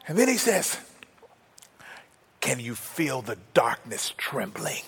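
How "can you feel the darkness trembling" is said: slow, breathy whisper full of awe; awed whisper. Retold or imitated. Robert in the park, whispering to Danielle in awe. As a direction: hush them, as if sharing a secret. Robert's hushed awestruck question.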